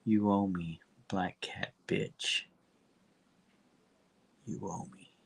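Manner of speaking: a hint of a gangster calling in a debt, softly; Kingpin menace. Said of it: "The Kingpin says".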